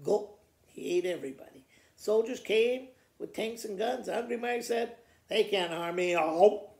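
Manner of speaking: ordinary delivery, nothing marked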